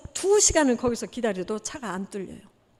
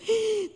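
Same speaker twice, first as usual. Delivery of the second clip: startled gasp; 10 semitones higher than usual. Retold or imitated. Startled at the door.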